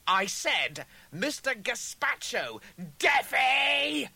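high, loud jeer building to a shout; mocking taunt. A narrator's reading. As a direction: spell it out as if to someone deaf. Duplicate repeats his insult mockingly.

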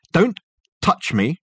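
curt warning, broken into hard pieces; snapped. A narrator's reading. Dirk to the ghost reaching for his arm, snapped. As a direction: snap it to fend him off. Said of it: "snapped Dirk".

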